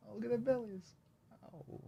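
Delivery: doting whisper-coo. Real, not acted. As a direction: coo over the belly. Cooing at dog belly.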